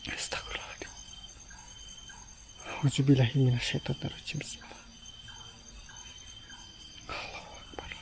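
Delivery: frightened breathy whisper; scared whisper. Real, not acted. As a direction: whisper prayers in dread. Whispered prayers in fear.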